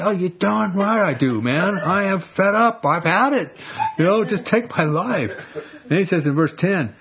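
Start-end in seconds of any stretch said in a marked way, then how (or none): 0.0-5.3 high, indignant retort; talking back. Acted. Jonah talking back to God, indignant. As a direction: snap back at God defiantly. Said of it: "So he's talking back to God".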